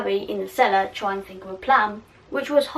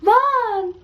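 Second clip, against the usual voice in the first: high, louder cry of Ron; desperate call, hammed up; Hermione calling out; 11 semitones higher, 4 dB louder than usual. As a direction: scream his name from below. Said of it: "Hermione screaming his name".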